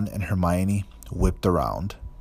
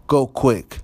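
urgent hoarse urging; 6 semitones higher, 7 dB louder than usual. A narrator's reading. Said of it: "he said hoarsely".

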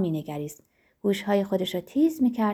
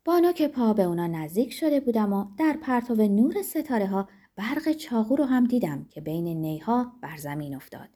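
ordinary delivery, nothing marked

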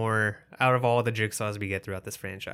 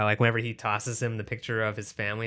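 usual speaking voice

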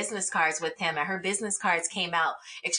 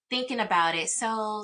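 ordinary talk

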